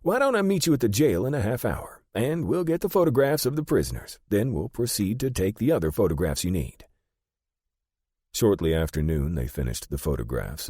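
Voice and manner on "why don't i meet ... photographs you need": high-pitched, eager little shopkeeper voice; higher-voiced, brisk photographer; brisk small man. A narrator's reading. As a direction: greet customers briskly in a raised pitch. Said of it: "brisk, small man".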